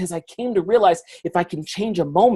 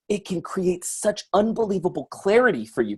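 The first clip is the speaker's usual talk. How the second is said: ordinary talk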